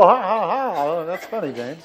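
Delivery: imitating a polite, half-hearted laugh; pitying fake laugh. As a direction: humour a bad joke out of pity. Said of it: "feel sorry for me, they laugh".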